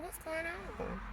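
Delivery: a high, soft, baffled question; puzzled. Retold or imitated. Herself asking the plumbers, high and puzzled. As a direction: ask it like you can't believe it. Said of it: "I like".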